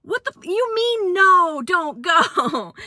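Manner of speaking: high, slow, outraged disbelief; incredulous ex; mock furious ex; angry ex. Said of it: "she was like".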